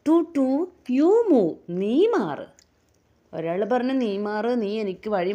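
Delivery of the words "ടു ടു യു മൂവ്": high, loud honking car voice. Acted. Teacher voicing the car telling the bus to move. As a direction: toot and order the bus aside. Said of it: "car എന്ത് പറഞ്ഞു?".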